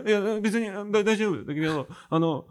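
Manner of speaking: high, tremulous teary confession, lightly voiced; mock the girl's shaky, tearful voice; teary cabaret girl. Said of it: "声震えてて". (高い声で震えながら泣きそうに話すキャバ嬢の真似)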